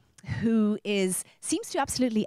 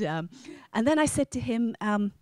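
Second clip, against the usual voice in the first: ordinary talk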